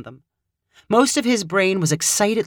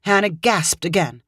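plain reading voice

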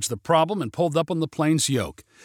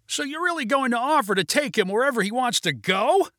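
high, incredulous raised voice; shout, with the volume held back; restrained shout; 9 semitones higher than usual. A narrator's reading. Passenger questions pilot's offer, restrained high shout. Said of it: "shouted Neil".

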